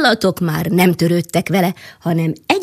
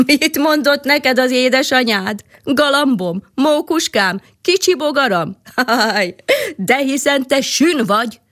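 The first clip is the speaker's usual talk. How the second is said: laughing; 5 semitones higher, 3 dB louder than usual. A narrator's reading. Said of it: "kacagni kezdett".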